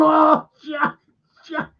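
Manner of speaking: high mock shout of dismay; retold alarmed cry. Man finds his mate frozen, mock alarmed shout. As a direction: call out high, imitating the alarm. Finding Jack frozen in the freezer.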